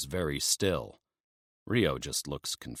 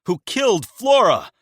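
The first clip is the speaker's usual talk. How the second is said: furious accusation; 9 dB louder than usual. Acted. Emil furious at traitor.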